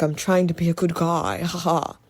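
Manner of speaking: a hint of menace, not really threatening; mock ominous villain. Mocking Wesker playing good guy, mildly ominous. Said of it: "He's, like, ominous, but he's not even threatening".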